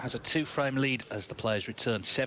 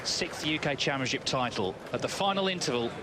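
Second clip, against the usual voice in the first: sports announcer; 6 semitones higher than usual. Acted. End of session summary.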